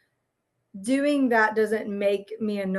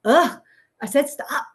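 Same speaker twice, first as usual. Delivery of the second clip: louder, higher exasperated groan into the words; annoyed snap; 5 semitones higher, 4 dB louder than usual. Retold or imitated. Parent imitating her own irritated moment with her kids, mock snap. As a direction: act out losing patience for a moment. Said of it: "I can have a one-off where I'm like".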